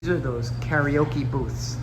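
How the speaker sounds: ordinary talk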